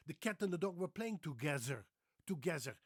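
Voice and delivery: Dutch English with th as z; exaggerated Dutch accent, "together" as "togezzer". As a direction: mimic a Dutchman buzzing the th. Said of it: "try to do the T H as a Z".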